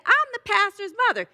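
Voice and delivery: high, loud mock outrage; indignant announcement. Elderly lady reveals herself to the usher, indignant. Indignant lady's punchline in a joke.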